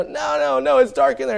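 high, loud frightened protest; scared kids refusing. Retold or imitated. Scared kids refusing to go into the dark, high protest.

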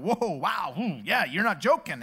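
mildly awed, drawn-out reactions; stunned listening. Retold or imitated. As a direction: react as if taken aback by a confession.

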